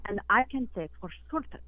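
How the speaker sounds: broken English in a put-on Russian accent; hammed-up Russian accent on a radio call; fake Russian accent. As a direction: play a Russian caller with a thick fake Russian accent. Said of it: "your fake accent".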